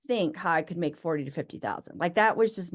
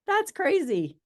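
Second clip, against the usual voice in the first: retold gasp of disbelief, pitched much higher; stunned disbelief; 12 semitones higher, much slower than usual. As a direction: replay the shock of seeing the number. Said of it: "And it was just like".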